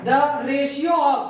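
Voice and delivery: drawn-out dictation, very slow. Real, not acted. Teacher dictates the ratio question to the class, slow dictation. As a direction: spell out the question at note-taking speed.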